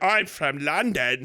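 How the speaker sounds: lightly mocked Scot faking a London accent; fake London accent. Scot pretending to be from London, mock London accent. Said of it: "they can all hear that weird Scottish accent".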